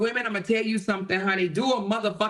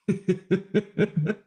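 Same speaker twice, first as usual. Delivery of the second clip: real laugh; 4 semitones lower than usual. Real, not acted. Laughing at her own Tia Kemp joke, laughing.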